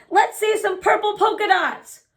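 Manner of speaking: put-on high, loud kid's call across the classroom; a classmate yelling a dare. A narrator's reading. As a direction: shout it to tease her. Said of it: "One yelled out".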